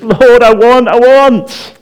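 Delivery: put-on high, loud childish wail; whining child. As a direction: cry out like a demanding toddler. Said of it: "wants to cry and to scream".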